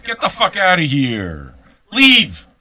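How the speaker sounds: high, loud barked order to leave; shouted dismissal. Real, not acted. Host to offended listeners, a loud shouted leave. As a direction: throw them out with one loud word.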